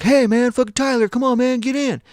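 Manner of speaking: loud, high-pitched urging yell; calling out. Retold or imitated. Rider yells at Tyler to get in the car, high loud call. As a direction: holler for a straggling friend. Passenger yelling to friend to get in.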